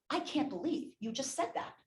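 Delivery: indignant mock confrontation; retold angry disbelief. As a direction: act out an offended client's disbelief. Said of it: "she was so angry with me".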